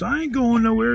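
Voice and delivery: high, whiny refusal; mock protest. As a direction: imitate a drunk squawking as he's dragged. Drunk neighbor dragged downstairs.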